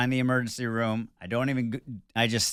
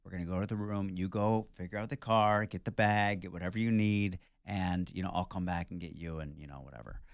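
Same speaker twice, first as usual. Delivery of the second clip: softer, lower, soothing reassurance; calm comforting nurse; 3 semitones lower, 6 dB quieter than usual. Retold or imitated. Hospital lady calmly telling the husband what to do. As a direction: settle the panicked husband gently. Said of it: "so calm and so comforting".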